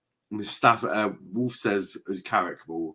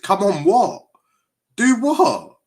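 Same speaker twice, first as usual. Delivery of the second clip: higher and louder, scoffing; incredulous retort; 10 semitones higher, 7 dB louder, slower than usual. Real, not acted. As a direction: fire it back in disbelief. Mocking a chat comment.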